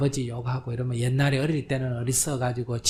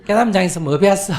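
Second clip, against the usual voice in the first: higher, louder mock lecturing; mock scolding; 6 semitones higher, 4 dB louder than usual. Child lectures her mother about the dharma, mock-scolding. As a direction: ham up a preachy know-it-all. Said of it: "이런 소리 하면 안 돼".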